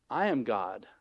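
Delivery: low, soft, settled declaration; hushed, deep statement of authority; calm divine authority. Acted. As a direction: state it quietly as God. God answering the objection.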